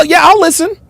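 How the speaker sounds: high, smiley mock agreement; smiling imitation. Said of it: "look at the smile".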